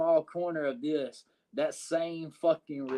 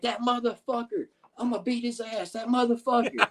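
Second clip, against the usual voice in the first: put-on higher, slurred drunk-guy voice; drunk Mark Southern; 8 semitones higher than usual. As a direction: voice him as a drunk ready to fight. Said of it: "He's like".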